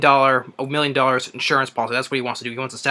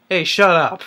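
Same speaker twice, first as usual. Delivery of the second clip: louder, higher snap at the heckler; mock shut up; 5 semitones higher, 5 dB louder than usual. Madsen's character silencing a heckler, mock snapping. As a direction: tell the booer off, half-playful. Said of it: "Michael Madsen's like".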